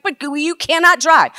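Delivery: high, loud retort; yelled objection. Retold or imitated. Best friend blocking the door to stop her driving, yelling. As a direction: shout back to forbid it. Friend arguing back loudly.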